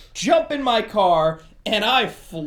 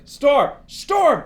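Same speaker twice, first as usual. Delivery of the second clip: raised, louder repeated name call; insistent calling; 7 semitones higher, 7 dB louder than usual. Retold or imitated. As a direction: call insistently to get his attention. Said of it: "I was trying to get his attention".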